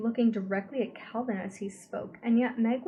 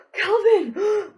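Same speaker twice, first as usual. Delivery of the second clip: much higher, loud desperate cry; 11 semitones higher, 13 dB louder than usual. A narrator's reading. Meg crying out to Calvin for help. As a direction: scream his name for help.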